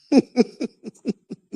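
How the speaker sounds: high chuckling laugh. Real, not acted. Speaker admits he wouldn't know Sicilian, high laugh. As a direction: laugh at your own admission. Amused at own admission.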